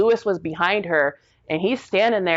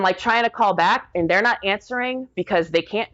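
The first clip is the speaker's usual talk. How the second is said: ordinary delivery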